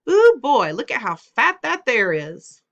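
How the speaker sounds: loud, high holler across the playground; boy yelling out at a squirrel. A narrator's reading. Boy hollering at a fat squirrel across the street, loud calling out. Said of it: "hollered out".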